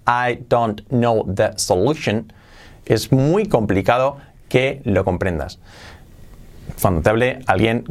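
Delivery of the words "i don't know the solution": slow, careful "I don't know the solution", each word apart; well-pronounced, unlinked. Shown as a demonstration. Teacher shows it even well pronounced, careful unlinked "I don't know the solution". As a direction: enunciate "don't know" fully, one word at a time. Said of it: "incluso bien pronunciado".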